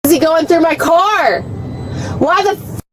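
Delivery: real indignant yell, rising in pitch; screaming. Real, not acted. Detained driver to officer about car search, screaming. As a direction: demand an answer in a high, outraged cry. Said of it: "Why are you screaming?".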